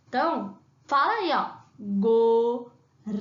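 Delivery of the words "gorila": "Gorila" spoken very slowly as go-ri-la; syllable by syllable. Shown as a demonstration. Teacher prompting kids to say "Gorila" with her, very slow. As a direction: model "Gorila" for the class to repeat, slowly. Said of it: "quantas sílabas".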